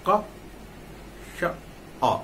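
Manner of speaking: क, श, अ with long pauses between; sound-by-sound demo. Shown as a demonstration. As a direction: take क्ष apart as क, श, अ, one at a time. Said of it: "कुल अढ़ाई ध्वनियां निकलती है". (क, श, अ रुक-रुककर अलग-अलग)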